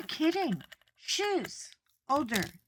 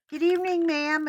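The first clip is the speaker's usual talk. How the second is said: put-on high young boy voice; 9 semitones higher, 8 dB louder, faster than usual. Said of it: "he was like".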